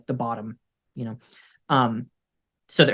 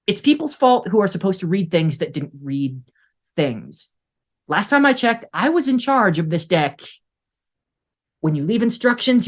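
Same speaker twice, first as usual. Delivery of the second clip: high, sharp, annoyed doctor in charge; indignant outburst; 5 semitones higher than usual. Acted. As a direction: cut her off and assert authority. Doctor cutting assistant off.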